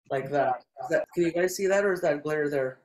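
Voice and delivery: normal talking voice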